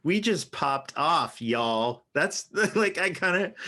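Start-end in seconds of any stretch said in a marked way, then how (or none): 0.0-2.0 put-on Southern accent. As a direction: try the slang in a Southern accent. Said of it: "and with the accent too".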